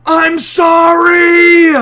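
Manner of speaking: drawn-out high scream of sorry; screamed apology. Retold or imitated. Usopp screaming his apology to the crew, long high shout. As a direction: belt out sorry slowly at the top of your voice.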